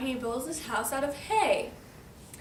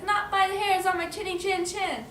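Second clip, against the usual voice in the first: high, sing-song refusal; defiant storybook pig; 7 semitones higher, 4 dB louder than usual. Acted. Pig answers wolf through the door, high chanted defiance.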